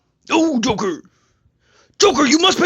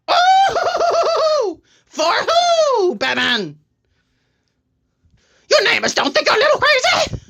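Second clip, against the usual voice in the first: theatrical Joker with drawn-out, swooping cries; manic Joker; 10 semitones higher, slower than usual. Acted. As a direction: wail and taunt Batman as a gleeful Joker.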